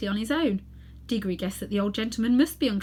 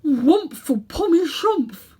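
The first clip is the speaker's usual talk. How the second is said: slow, loud mumble through a hat; muffled inside a hat; 7 semitones higher, 8 dB louder, much slower than usual. Acted. Uncle Andrew answering from inside his crushed hat, muffled. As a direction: speak as if buried under a hat. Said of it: "came Uncle Andrew's voice from inside the hat".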